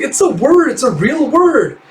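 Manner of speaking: squeaky protest, slowing on the last words; mock outraged insistence; high indignant insisting. Joe insists the word is real.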